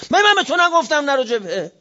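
put-on high-pitched scolding yell at a young man; retold angry shout. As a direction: shout him down, as the Imam scolding. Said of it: "داد زد سرش". (فریاد دعوا با صدای بلند و زیر)

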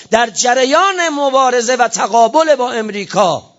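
high, slow, hammered-out sermon climax; full-force preaching. Real, not acted. Preacher denouncing whoever serves America, fiery full-force delivery. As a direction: build phrase by phrase to a high, loud denunciation.

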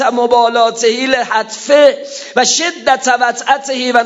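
Arabic sermon recitation. Acted.

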